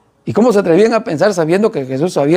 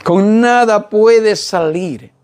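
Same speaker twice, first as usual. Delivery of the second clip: very slow, deliberate, with "nada" drawn out; stress on "nada"; much slower than usual. Shown as a demonstration. Preacher insisting there is no other means, slow stress on "nada". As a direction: spell it out slowly, weighting "nada". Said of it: "escucha la palabra nada".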